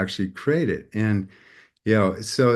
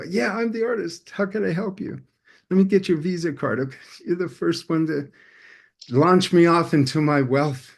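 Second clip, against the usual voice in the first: mock eager excitement; 6 semitones higher than usual. Eagerly answering his first caller.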